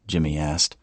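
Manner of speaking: ordinary delivery, nothing marked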